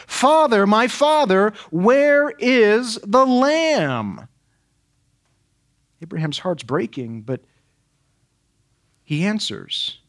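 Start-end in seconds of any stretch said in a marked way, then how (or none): 0.1-4.3 high, loud, slow cry of a son to his father; Isaac's plea. Acted. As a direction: plead like a boy calling his father.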